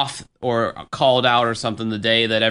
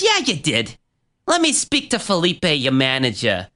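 Keith impression, Massachusetts accent; 4 semitones higher than usual. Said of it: "a impression of him".

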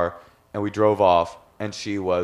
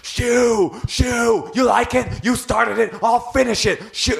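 imitated scream; 12 semitones higher, slower than usual. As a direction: shriek it like a furious stranger. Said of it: "starts screaming".

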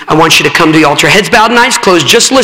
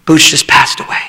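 gentle sad telling; 3 semitones lower than usual. Retold or imitated. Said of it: "I said".

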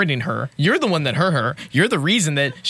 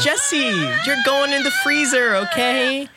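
high silly voice; 10 semitones higher, slower than usual. Acted. Dad in high voice.

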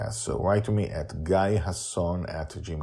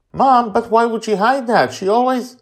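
high, loud whiny protest; high indignant son's voice; 9 dB louder than usual. Acted. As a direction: argue back like a grown son. Justin protests to mother.

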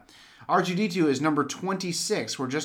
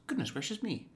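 higher, softer fussy droid line; C-3PO voice; 6 semitones higher, 8 dB quieter than usual. Retold or imitated. Quoting C-3PO to a fellow fan. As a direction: fret like a prim protocol droid. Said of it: "he goes".

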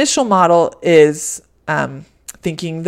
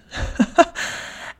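quick laugh; 11 semitones higher, 4 dB quieter than usual. Real, not acted. Laughing at herself about motherhood.

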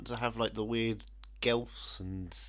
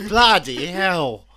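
mock shocked gasp; 7 semitones higher, 12 dB louder, much slower than usual. Mock shocked Aussie reaction.

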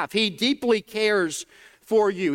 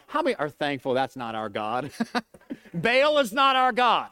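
usual speaking voice

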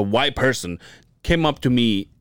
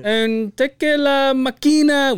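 much higher, slow mock gringo voice; inspector dropping Spanish words; 13 semitones higher, much slower than usual. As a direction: drag out la maquina like a clumsy gringo. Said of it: "drops Spanish words that he knows".

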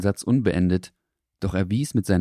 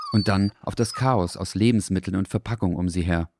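ordinary delivery

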